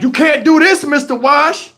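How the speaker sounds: high-pitched imitated teasing onlookers; mock excited kids' challenge; crowd hyping. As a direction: goad the old man on, voice up high. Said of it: "They like".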